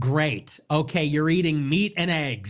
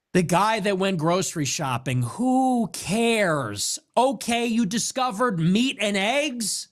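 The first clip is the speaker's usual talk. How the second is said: normal talking voice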